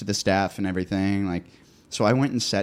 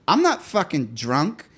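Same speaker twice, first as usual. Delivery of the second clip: higher, mock drunken indignation; retold yelling; 6 semitones higher than usual. Drunk date denies being drunk, mildly mock-yelled. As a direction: imitate a drunk date's protest, not at full yell. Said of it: "she started yelling".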